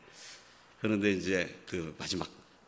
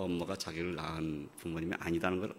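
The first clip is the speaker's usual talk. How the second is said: ordinary talk, nothing marked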